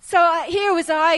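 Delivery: ordinary talk, nothing marked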